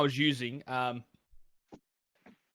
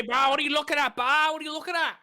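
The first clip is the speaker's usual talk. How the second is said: high, loud aussie eshay slang; 13 semitones higher, 7 dB louder than usual. Acted. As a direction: bark it like a street eshay. Imitating eshays at train station.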